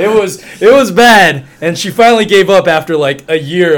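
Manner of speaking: laughing loudly while telling it. Real, not acted. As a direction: burst out laughing at the memory. Laughing at his own story.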